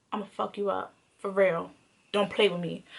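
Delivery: low, hushed warning; retold quiet threat. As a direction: drop low and soft to menace him. Acted threat to boyfriend.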